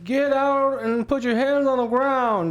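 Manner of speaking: high, barked cop order; police command. Retold or imitated. Police ordering the stolen-car driver out, high shouted command. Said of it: "the police are like".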